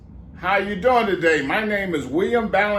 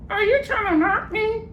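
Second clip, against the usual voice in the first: very high parrot echo; mock him back like a parrot; parrot mimicking.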